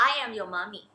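slow, soft "I am your mommy"; slow tender line to baby. Shown as a demonstration. Mother tells her baby who she is, slow and soft. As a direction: tell the baby gently, slowly. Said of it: "หรือเราจะใช้คำว่า".

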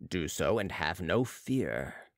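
low, slow, calm voice; deep, unhurried reassurance; Balsamo reassuring. A narrator's reading. Balsamo reassures the chief surgeon, low and slow.

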